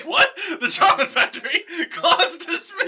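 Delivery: high-pitched, giggly disbelief; mock astonishment. Comic teacher hearing factory news and dismissing class, giddy astonishment. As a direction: gasp it out high, laughing through it.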